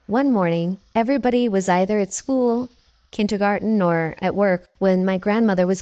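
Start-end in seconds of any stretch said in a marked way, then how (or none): none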